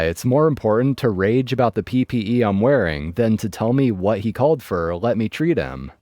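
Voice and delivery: ordinary delivery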